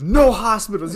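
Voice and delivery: emphatic mock-stern shout. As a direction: imitate the caller firmly barking a refusal. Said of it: "stern goes".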